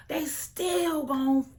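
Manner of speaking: very high, loud, slow screamed threat; screaming. Retold or imitated. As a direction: yell it through tears of rage. Quoting Janet in fight.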